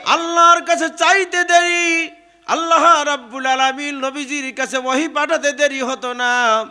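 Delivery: high, loud, sustained sermon cry; full-force preaching. Acted. Preacher hails Ali before the congregation, full-force preaching.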